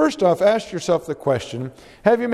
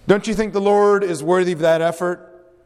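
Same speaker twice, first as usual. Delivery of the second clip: ordinary talk, nothing marked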